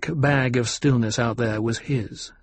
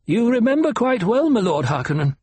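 put-on old doctor voice; 10 semitones higher than usual. A narrator's reading. Doctor presses the Baron.

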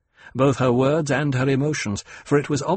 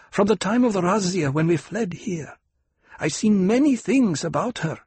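Fremen woman voice; 7 semitones higher than usual. Acted. Fremen nurse speaking of Aliyah.

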